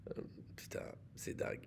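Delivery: soft whispered aside; whispering. Real, not acted. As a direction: whisper it in disbelief. Unvoiced aside.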